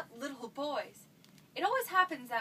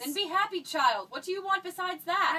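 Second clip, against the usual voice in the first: put-on louder and higher, scolding the sulky child; exasperated mother; 5 semitones higher, 7 dB louder than usual. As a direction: lose patience and snap at her. Mother snaps at stubborn daughter.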